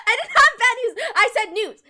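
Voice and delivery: high laughing defence of the announcement; laughing protest. Real, not acted. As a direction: laugh it out while defending yourself. Defending against bad news claim.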